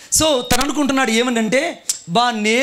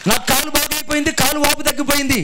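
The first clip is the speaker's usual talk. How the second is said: raised, building sermon voice; preaching; 4 semitones higher than usual. Acted. Pastor preaching the lesson to his church, rising fervour. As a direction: preach the point to the congregation.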